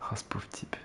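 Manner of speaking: soft pitying whisper. Real, not acted. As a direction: whisper it with pity. Whispered pity.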